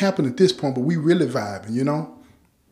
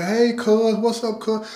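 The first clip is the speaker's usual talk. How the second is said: higher-pitched imitation of friendly cousins; imitated eager greeting; 7 semitones higher, slower than usual. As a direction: greet a long-lost cousin, acted out higher. Relatives greeting him at bus station.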